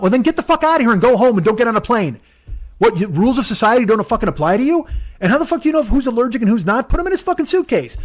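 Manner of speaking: high-pitched, indignant rant; acting out an outraged scolding; exasperated telling-off. Acted telling-off of dog owner.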